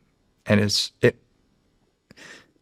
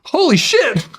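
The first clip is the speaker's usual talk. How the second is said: much higher, louder shocked exclamation; stunned kid; 4 dB louder, slower than usual. Retold or imitated. Kid hears he got the role.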